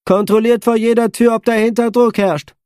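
lightly voiced female officer, brisk; commanding woman. A narrator's reading. Chris gives orders to crew.

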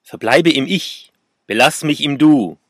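haunting inner voice. A narrator's reading. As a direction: intone it loudly like a voice echoing in the head. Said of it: "Sodons Stimme geisterte durch meinen Kopf".

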